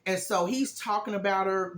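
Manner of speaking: ordinary delivery, nothing marked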